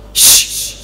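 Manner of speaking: unvoiced sh, rushing air; long sh hiss. Shown as a demonstration. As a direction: hiss a strong sh. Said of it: "Seperti itu".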